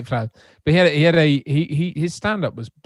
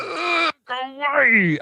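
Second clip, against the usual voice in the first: annoyed groan; 13 semitones higher, much slower than usual. Retold or imitated. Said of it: "and it's like".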